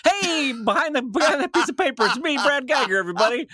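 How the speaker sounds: high goofy voice from behind a photo; silly hidden voice. Retold or imitated. Podcaster acting out a video gag for his cohost, high goofy voice. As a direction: pop out with a high silly greeting. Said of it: "you go".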